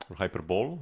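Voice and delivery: hyperbole said as "hyper-bowl"; hyper-bowl reading. Shown as a demonstration. Streamer unsure how to say the word, hyperbole as "hyper-bowl". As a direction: say hyperbole like "bowl", without a final ee. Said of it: "Do you say hyperbole or hyperbole".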